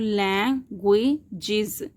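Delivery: लैंग्वेजेस in three beats, lang, gwe, jiz; split into syllables. Shown as a demonstration. Teacher showing three syllables, लैंग्वेजेस split in three. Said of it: "जैसे कि मैं इसको बोलती हूँ".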